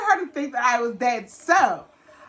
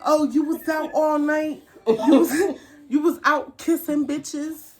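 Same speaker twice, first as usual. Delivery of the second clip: put-on higher pitched, jeering voice of the jealous girlfriend; mocking accusation; 6 semitones higher than usual. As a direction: throw her accusation back with sneering disbelief. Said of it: "She's like".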